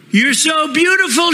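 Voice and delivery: high, adoring woman's cry. Acted. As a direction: gush over the snake. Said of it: "she cried".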